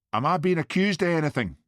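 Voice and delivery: a touch of angry indignation; angry demand. A narrator's reading. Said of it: "demanded Missus Bannerman angrily".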